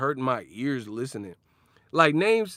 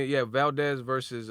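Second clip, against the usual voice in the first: usual speaking voice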